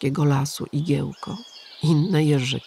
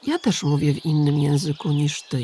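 plain reading voice

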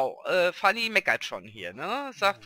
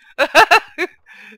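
loud burst of laughter, higher; 10 semitones higher, 16 dB louder than usual. Real, not acted. Laughing at the cat jumping into arms, loud.

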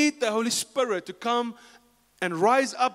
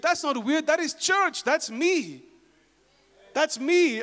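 rising preaching emphasis; 7 semitones higher, slower than usual. Acted. Preacher rebuts the critics.

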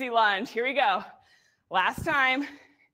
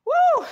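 high loud woo to fire up the class; a big excited whoop; cheering whoop; 8 dB louder than usual. Real, not acted. Coach cheering the class through the end of a set, loud whoop.

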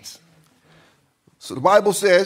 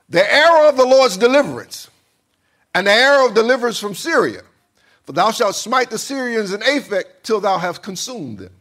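loud, high declaration easing down at the end; prophetic proclamation; 4 semitones higher, 5 dB louder than usual. Acted. Prophet Elijah declaring deliverance to the king, proclaiming. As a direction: proclaim it as a prophet, starting big.

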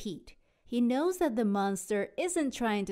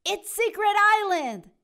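high, loud excited call; excited announcement; 10 semitones higher, 8 dB louder than usual. Acted. Captain shows the crew the island, excited. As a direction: announce it with delight. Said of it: "says Captain Pete".

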